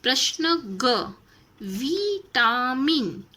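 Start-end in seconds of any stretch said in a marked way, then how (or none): 1.6-3.2 विटामिन drawn out very slowly; teacher modelling विटामिन slowly; careful pronunciation. Shown as a demonstration. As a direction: sound out विटामिन slowly for the class. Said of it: "उच्चारण करके आपको शब्द पहचाना".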